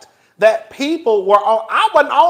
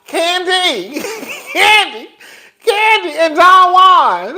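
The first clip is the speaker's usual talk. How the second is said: laughing call-out; 5 semitones higher, much slower than usual. Real, not acted. Calling out to Kandi, laughing.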